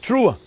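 quick rising warbling wail then a word; demonstrated wail. Shown as a demonstration. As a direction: mimic the trembling trua cry. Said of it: "Or is it".

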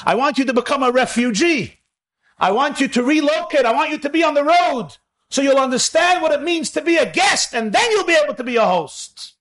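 rising preacher's passion, building to a peak; building fervor. Acted. Voicing God's call, building up.

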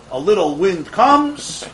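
ordinary talk

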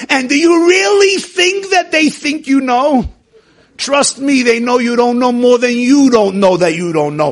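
full-voiced rabbi's sermon; preaching. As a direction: preach it out to the room with force. Preaching to class about doubts.

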